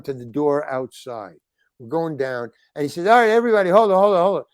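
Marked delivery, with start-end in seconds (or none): 3.1-4.4 loud, high call to hold on; calling out to stop. Retold or imitated. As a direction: stop everyone with a raised shout. Halting the group on the steps.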